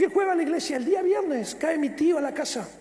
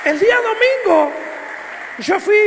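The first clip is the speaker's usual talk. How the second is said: much louder, higher, slow; preaching shout; 4 semitones higher, 6 dB louder, slower than usual. Real, not acted. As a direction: thunder it to the church. Climactic shouted testimony.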